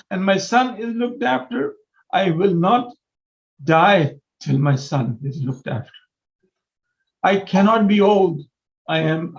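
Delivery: pleading from the heart with rising feeling; raw, emotional appeal to the mayor. Real, not acted. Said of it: "I'm being emotional here".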